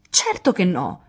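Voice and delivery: high, loud exasperation; indignant outburst. A narrator's reading. Jo is appalled at news about her sister, indignant outburst. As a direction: protest in rising outrage, scandalised.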